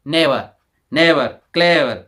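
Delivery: teacher slowly models never and clever; model pronunciation. Shown as a demonstration. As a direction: draw out never and clever without the r. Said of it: "मौखिक बनावट से ही समझ गए होंगे".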